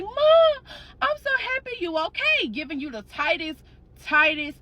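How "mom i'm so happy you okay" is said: put-on young son. Little son running into his mother's arms, high and relieved. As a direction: pitch it up like a relieved little boy.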